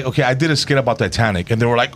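usual speaking voice

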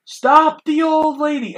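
high, drawn-out mock yell; loud, slow, high-pitched hammed-up cry; retold alarmed shout. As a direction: imitate shouting a desperate order. Retold man shouting to stop her.

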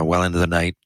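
normal talking voice

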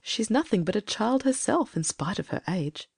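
softly spoken pity, in character. A narrator's reading. Judith thinks of her helpless sister Salome, pitying. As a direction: think it with quiet, understated pity. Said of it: "she thought pityingly".

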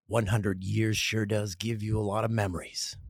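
low, measured announcer read; commercial voiceover. Shown as a demonstration. Showing Canadian Tire their rework, low voiceover read. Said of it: "Here's your voiceover part".

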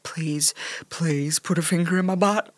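low, soft mock-seductive plea; sexy and deep. Host showing how he might have asked, sexy deep voice. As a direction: put on a deep sultry voice. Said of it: "trying to be real sexy and deep".

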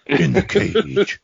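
slow booming announcer hype; monster truck ad. Pretend. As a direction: drag it out like a monster truck promo. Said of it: "W W E or monster truck voice".

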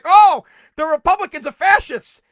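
loud sneering mock quote; mocking Democrats calling Republicans fascists, sneering parody. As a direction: parody the claim with scorn.

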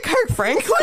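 surprised. Retold or imitated. Shocked hearing [name].

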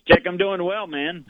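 normal talking voice

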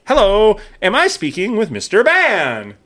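telemarketer. Retold or imitated. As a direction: put on a slow, high-pitched sales-call greeting. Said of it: "when you answer one of these spam calls".

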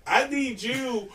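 high, loud, building laughing shout. Retold or imitated. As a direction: laugh while pushing him away.

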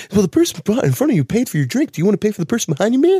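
high, rushing bro voice; imitating an overexcited barista; super excited barista. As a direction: pitch it up like a hyped coffee-stand dude. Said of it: "they're super excited".

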